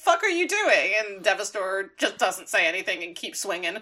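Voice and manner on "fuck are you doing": high-pitched mock disbelief; retold shocked protest. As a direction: protest as a pupil suddenly attacked by his master. Pupil attacked by his master.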